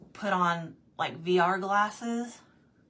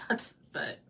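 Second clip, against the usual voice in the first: ordinary talk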